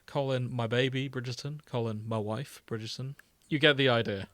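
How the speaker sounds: usual speaking voice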